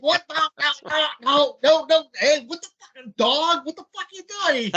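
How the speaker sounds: high mock rant at a buddy on the phone; hammed-up exasperated ranting; imitated phone rant. Cardone ranting at his buddy on the phone, high mock outrage. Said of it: "you just hear him rant".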